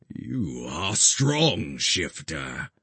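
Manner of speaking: put-on measured, solemn old guardian; slow, grave guardian voice. A narrator's reading. Olmec speaks to the creature.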